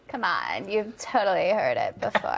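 mock fry, words crackling in the throat; vocal fry. Shown as a demonstration. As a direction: drag it out in a croaky fry. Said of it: "you've never heard of the fry?".

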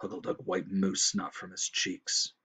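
usual speaking voice

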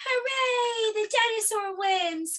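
high, drawn-out cheer; children cheering. Acted. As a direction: cheer like delighted kids. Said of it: "cried the children".

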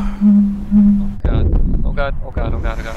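loud, repeated oh God; loud relieved groans. Real, not acted. Gamer finally lighting a cigarette, loud relieved groans.

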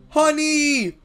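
delighted gasp. Acted. Bee girl discovering honey in the barn, thrilled.

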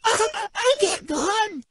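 high melodramatic "I can't go on"; mock dramatic suffering. Girlfriend mimics boyfriend's toe drama, melodramatic. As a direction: play the dying hero over a toe. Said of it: "he's like".

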